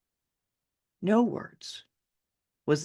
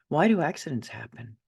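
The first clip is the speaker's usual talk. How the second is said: plain reading voice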